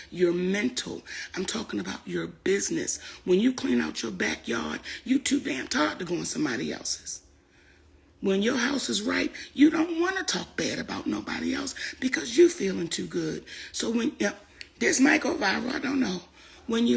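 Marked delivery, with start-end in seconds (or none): none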